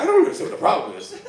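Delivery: high, fast, laughing bewilderment; mock bewildered. Walking back to his cot, baffled and laughing. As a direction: rattle it off, baffled and giggling. Bewildered after gangsters turn.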